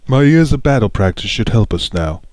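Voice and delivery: loud, lightly put-on Australian; a half-hearted Australian twang; mock Australian. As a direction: Prince Rince in a loose Australian accent. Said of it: "I guess he's Australian now".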